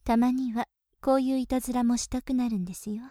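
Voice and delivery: normal talking voice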